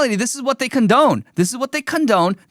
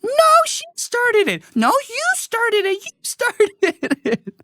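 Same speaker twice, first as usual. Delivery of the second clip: put-on much higher, slow bickering child voice; whiny little kid; slower than usual. Becky bickering with Jenny, high slow whiny kid voice. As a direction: squeal it back like a toddler in a spat. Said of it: "Becky's like".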